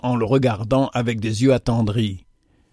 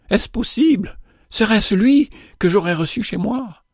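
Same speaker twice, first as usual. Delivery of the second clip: higher, wondering questions; amazed disbelief; 8 semitones higher than usual. Acted. Widow realising who visited her, amazed disbelief. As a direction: ask it in awe. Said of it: "s'écria madame Chamusot".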